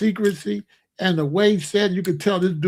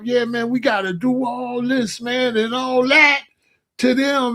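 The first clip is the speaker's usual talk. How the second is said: higher, building to a shout; mock fiery rant; 4 semitones higher than usual. Young radical to a meeting of revolutionaries, mock fired-up rant. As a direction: imitate an overeager agitator getting carried away.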